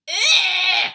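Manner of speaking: huge pained giant roar "Ugh"; roar of disgust. A narrator's reading. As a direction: bellow it out in revulsion. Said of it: "roared the blood bottler".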